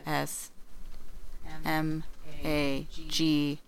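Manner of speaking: slow and soft, letter by letter; spelling it out. Shown as a demonstration. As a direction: spell out phantasmagoria one letter at a time. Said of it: "Can you use it in a sentence?".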